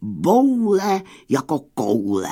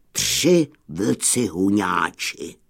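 plain reading voice

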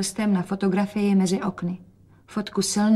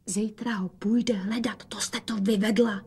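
whisper; 5 dB quieter than usual. Acted. Rozetka whispers a reproach to her mother. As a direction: hush it, reproachful secret. Secret talk behind father's back.

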